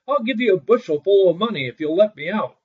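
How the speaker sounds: high, loud begging; frantic plea. A narrator's reading. Sexton in the chest, pleading loudly with Little Claus. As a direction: bargain in a panic. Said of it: "shouted the sexton".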